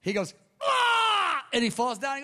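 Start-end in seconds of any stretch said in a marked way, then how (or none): 0.6-1.4 high, loud mock scream; retold cry. Preacher imitates a newcomer falling under prayer, high cry. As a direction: cry out like someone struck down. Man falls down crying out.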